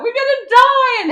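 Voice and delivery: loud, high, slow terrified wail; panicked cry. Retold or imitated. On the turbulent flight, loud panicked wailing. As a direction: wail it like the plane is going down. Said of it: "I'm sat there crying".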